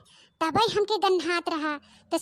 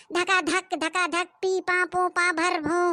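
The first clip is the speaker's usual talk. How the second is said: mock fart noises; slower than usual.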